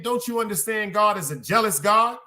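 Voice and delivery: ordinary talk, nothing marked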